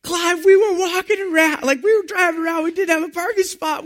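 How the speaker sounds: high, loud excited retelling; laughing, carrying on. Retold or imitated. Excited couple telling Clive about their parking spot, giddy. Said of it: "we were laughing and carrying on".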